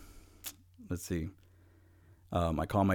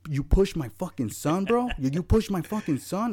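higher-pitched, heated accusation; retold angry dad; 7 semitones higher than usual. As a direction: confront him over your son, acting it out. Dad confronts man who pushed son.

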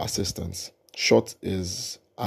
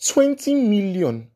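trying not to laugh; 11 semitones higher, 4 dB louder, slower than usual. Real, not acted. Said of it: "I'm losing it at this point".

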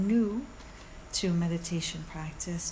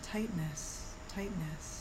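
softly naming it to yourself; much softer, falling, almost to yourself; gentle inner naming; 8 dB quieter, slower than usual. Shown as a demonstration. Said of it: "gently say it to yourself".

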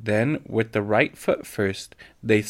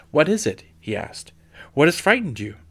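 loud, high alarmed question; 6 semitones higher, 6 dB louder than usual. A narrator's reading. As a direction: demand to know what's wrong. Father alarmed at girls bursting in.

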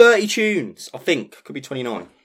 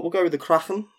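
put-on Kraken in a light Scouse accent, the k in the middle breathy and rasped; Liverpool accent; 8 semitones higher, 3 dB louder than usual. Host naming his rum, in a light Liverpool accent. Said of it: "I have to say Kraken like that".

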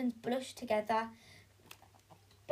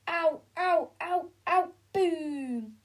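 1 comic ows; 4 semitones higher, 3 dB louder, slower than usual. Acted. Fight sound effects.

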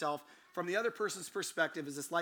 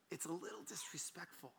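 very soft, hesitant reproach; barely-voiced, delicate objection; hushed complaint; 12 dB quieter than usual. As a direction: voice a timid complaint under the breath. Voicing hesitant lady's complaint.

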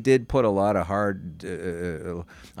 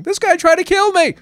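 retold shriek, much higher and louder; woman screaming; 9 dB louder than usual. Woman running down the street accusing him, shrieking. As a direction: scream it like she's fleeing an attacker. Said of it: "came running down the street waving her arms going".

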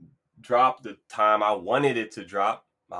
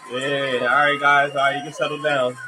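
hammed-up host soaking in imaginary applause, higher and louder; mock crowd hype; 5 semitones higher, 4 dB louder, slower than usual. Host pretending his audience is applauding, mock triumphant. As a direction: play to a cheering crowd that isn't there. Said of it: "Where's the laughs at?".

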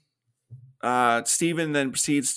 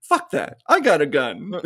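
mock bravado; 8 semitones higher, 4 dB louder than usual. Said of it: "he's like".

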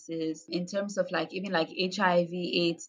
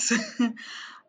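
breathy laugh; 4 semitones higher than usual. Real, not acted. Guest admitting it's a lot, breathy laugh.

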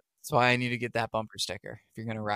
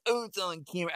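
mock driver voice; 6 semitones higher, faster than usual. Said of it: "I have changed his voice a million times".

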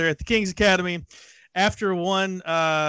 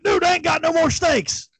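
high shouted complaint; retold angry yell; 11 semitones higher than usual. Coach retelling his friend's steak outrage, high shout. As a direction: yell it like a furious big guy. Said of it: "He's like".

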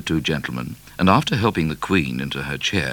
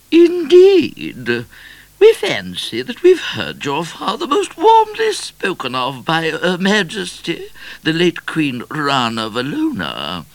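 very high, regal voice; haughty queen; 14 semitones higher, 7 dB louder than usual. A narrator's reading. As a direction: speak as a grand, high-voiced monarch.

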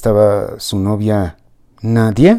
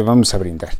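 ordinary delivery, nothing marked

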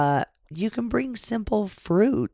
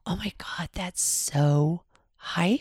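whispered, slowing right down; 7 dB quieter than usual. Retold or imitated. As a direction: hush it like a shocked aside. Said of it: "and I went".

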